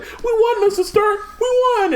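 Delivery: put-on high, boyish pleading voice; a kid's voice begging his mentor; teen Peter's high, tearful plea; young Spider-Man voice. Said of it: "he's just like".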